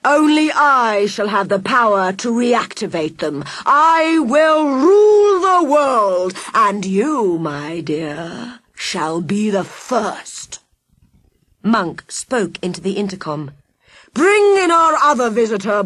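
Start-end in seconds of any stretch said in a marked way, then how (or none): 0.1-10.6 villain boasting to his captive; megalomaniac villain. Acted. Villain claims world rule. 14.2-15.8 loud, high order over the intercom; villain summoning his henchman; commanding villain. Acted.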